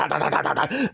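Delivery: quick repeated barks; imitated dog barking. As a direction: bark fast like a warning dog. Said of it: "the dog's looking at me like".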